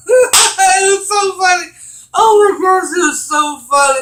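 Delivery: high, loud, laughing. Real, not acted. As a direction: crack up while saying it. Laughing at story.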